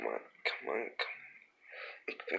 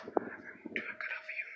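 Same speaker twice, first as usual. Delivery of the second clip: whisper; 11 dB quieter than usual. Real, not acted. Whispered aside.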